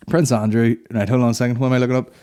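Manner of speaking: usual speaking voice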